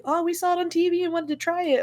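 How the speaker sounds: very high, breezy mimicry; put-on high voice, casual excuse; imitated friends. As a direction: answer lightly as the friends you're imitating.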